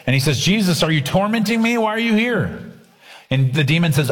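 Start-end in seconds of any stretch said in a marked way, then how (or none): 0.4-2.6 mild mock yell. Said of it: "the man is yelling at Jesus".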